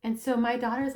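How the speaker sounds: normal talking voice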